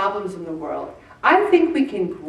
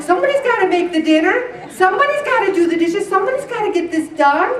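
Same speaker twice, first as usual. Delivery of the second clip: higher, louder, quick and insistent; busy Marthas; 9 semitones higher, 3 dB louder, faster than usual. Retold or imitated. Congregation as Marthas, higher, louder, faster insistence. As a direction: rattle off chores urgently. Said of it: "We're like".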